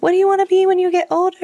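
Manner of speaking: lightly voiced, high quick question; adults quizzing children. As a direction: sing out a grown-up's question to kids. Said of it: "everybody's like".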